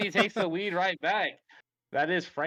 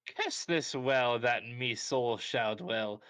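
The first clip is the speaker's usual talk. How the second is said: light Irish leprechaun voice, slow; slow sing-song leprechaun impression; 4 semitones lower, much slower than usual. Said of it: "we like your impressions".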